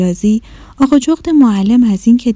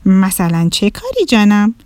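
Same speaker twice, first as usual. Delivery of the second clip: ordinary delivery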